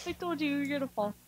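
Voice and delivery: fast giggly tease; laughing. Real, not acted. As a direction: laugh as you say it. Teasing friend who fell.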